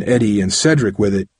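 ordinary delivery

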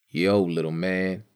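a touch of crushed-concrete roughness; rough as concrete. A narrator's reading. Wiry sick man greeting a boy, lightly rough. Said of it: "as rough as crushed concrete".

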